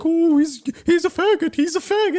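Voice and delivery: high, taunting teacher voice; mocking clown teacher. Teacher taunting a student across the classroom, high and mocking. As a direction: taunt in a high sing-song. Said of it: "just being like".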